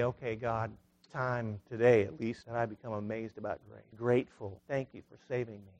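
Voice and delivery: a private thank-you whispered up to God; quiet prayer. Shown as a demonstration. Preacher modelling a prayer for the congregation, softly and humbly. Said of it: "lean back and say".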